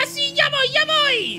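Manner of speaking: very high, loud shout; hurried calling out. Acted. Racer answers friends waiting at the race, eager shout. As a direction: yell that you're on the way.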